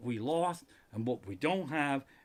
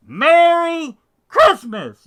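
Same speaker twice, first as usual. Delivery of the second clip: very loud, high, drawn-out shout; demonstrative holler; 13 semitones higher, 19 dB louder, much slower than usual. Shown as a demonstration. Proving a mask doesn't stop holiday wishes, huge drawn-out holler. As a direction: bellow it, stretching MERRY out long and high. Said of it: "You can still say it".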